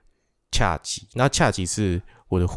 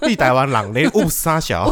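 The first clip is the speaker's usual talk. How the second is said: loud, higher mock scolding; mock indignant; 5 semitones higher, 5 dB louder than usual. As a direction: scoff at the fake oops.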